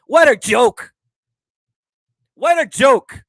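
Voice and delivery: loud, high scornful outburst; scoffing shout. Real, not acted. Vlogger mocking the boxer's top five, loud and scornful. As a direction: shout it out in disbelief.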